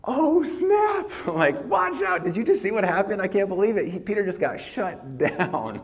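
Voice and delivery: high, loud gleeful "oh snap"; shocked disciples. Acted. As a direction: gasp and gossip like stunned onlookers. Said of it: "they're like".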